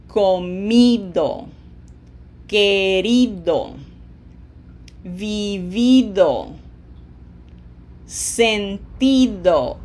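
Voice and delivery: comido, querido, vivido, sentido, each slow with a long stressed I; co-MI-do, que-RI-do, vi-VI-do, sen-TI-do, slowly stressed. Shown as a demonstration. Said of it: "It always falls on that vowel".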